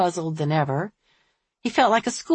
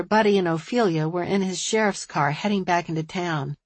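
ordinary delivery

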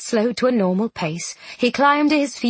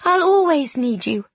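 higher voice, growing more upset; tearful tenderness; 7 semitones higher than usual. A narrator's reading. Miss Eyre confessing selfishness to her friend, tearful. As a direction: build from gentle to pained, pitch climbing.

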